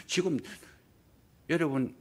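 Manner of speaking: usual speaking voice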